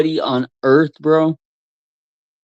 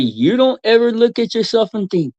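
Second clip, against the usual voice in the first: ordinary talk, nothing marked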